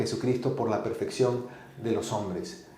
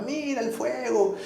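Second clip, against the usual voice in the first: awed parents; 11 semitones higher, 5 dB quieter, slower than usual. Retold or imitated. Parents pointing at the fire.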